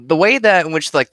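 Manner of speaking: ordinary talk, nothing marked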